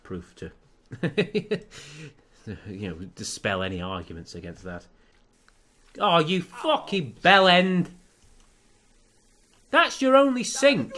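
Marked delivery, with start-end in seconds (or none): 6.0-10.9 loud, high outraged shout; furious disbelieving yell at the screen; exasperated yell. Real, not acted. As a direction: scold the Sim for wrecking the sink. Yelling at the Sim breaking sink.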